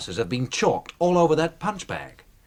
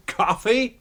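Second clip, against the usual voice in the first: amused disbelief; 8 semitones higher than usual. Acted. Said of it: "laughs at coffee".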